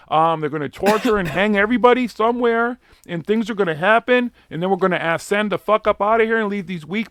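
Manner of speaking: high, loud ranting conspiracy preacher; shouting the rapture speech high and loud; DW impression. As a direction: do DW whipping up the crowd at full volume. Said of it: "D W's voice".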